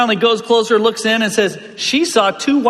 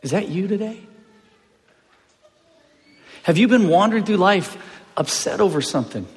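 usual speaking voice

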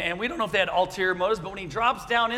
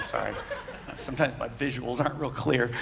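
ordinary talk, nothing marked

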